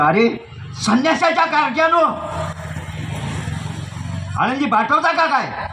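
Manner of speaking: acted hostile demand; hostile taunting challenge. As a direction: confront them as a story's bully, pitched high. Villain Isuba confronts the orphan children.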